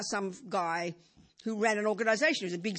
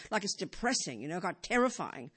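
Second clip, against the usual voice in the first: ordinary talk, nothing marked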